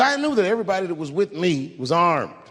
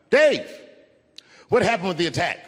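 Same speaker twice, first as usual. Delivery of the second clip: retold heckler yell; 2 semitones higher than usual. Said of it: "someone in the audience screamed out".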